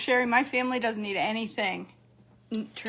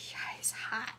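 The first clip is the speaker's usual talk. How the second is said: breathy whisper while eating; 9 dB quieter than usual. Real, not acted. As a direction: say it under your breath. Tasting hot food.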